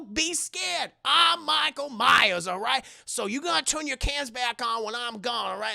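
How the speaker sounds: high, emphatic, dismissive lecture; hammed-up hustler setting people straight; mock rant. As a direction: open on a loud shout, then keep insisting. [name]'s acted rant at the crew.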